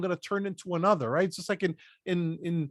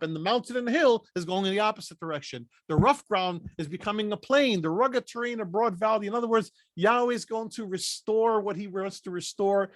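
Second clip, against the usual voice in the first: ordinary talk, nothing marked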